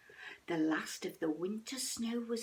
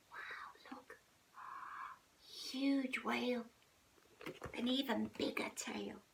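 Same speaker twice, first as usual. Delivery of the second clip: whispered in wonder; hushed whisper; 7 dB quieter than usual. A narrator's reading. Mother showing the cub the whale, whispered. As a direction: point it out in a hush.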